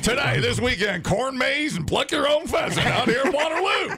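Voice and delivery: high, hammed-up promoter shout; mock event announcer. Host advertising a silly Waterloo weekend event, mock announcer shout. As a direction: holler it like a carnival ad.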